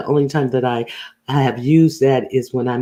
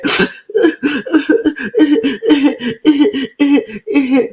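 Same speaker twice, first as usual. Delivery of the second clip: laugh; 12 semitones higher than usual. Real, not acted. Laughs after fake crying.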